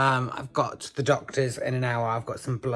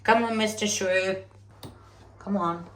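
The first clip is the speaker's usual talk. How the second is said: higher, sing-song coax to a tiny animal; coaxing pet voice; 6 semitones higher than usual. Real, not acted. Coaxing the shrew out of hiding, high cooing voice. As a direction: lure the little shrew out gently.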